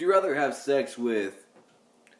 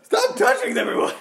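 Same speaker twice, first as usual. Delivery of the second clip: shrill mock scolding, much higher; high-pitched yelling; 11 semitones higher, 4 dB louder than usual. Yelling at someone in strip club.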